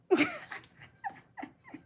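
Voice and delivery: squealing laugh; high shrieking laugh. Real, not acted. Shrieking laugh at own ignorance.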